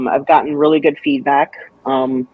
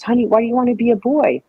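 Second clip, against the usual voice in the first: gentle mom; 8 semitones higher, faster than usual. Retold or imitated. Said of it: "she goes".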